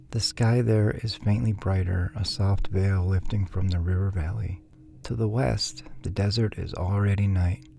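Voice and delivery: ordinary delivery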